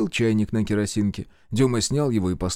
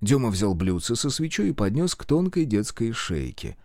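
plain reading voice

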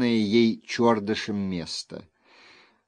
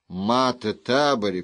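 ordinary delivery, nothing marked

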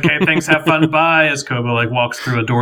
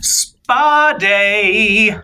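bright, higher, louder announcement; cheery announcer; 8 semitones higher, 4 dB louder than usual. Pretend. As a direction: sell it like a spa ad. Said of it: "I have to read this question in a specific way".

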